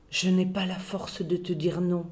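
soft, hushed and weak; whispered. A narrator's reading. Sick girl whispering to the boy, hushed and weak. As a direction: breathe it out, too tired to refuse. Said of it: "Elle a chuchoté".

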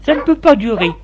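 high-pitched wife voice; storyteller's high, insistent wife; fed-up wife. A narrator's reading. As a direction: complain to your husband in a high voice. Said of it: "ta femme lui dit".

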